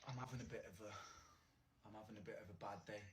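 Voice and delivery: very soft, hushed mock confession; a hushed, mock-mournful admission; mock-sad murmur. Streamer confessing his bad day to chat, hushed.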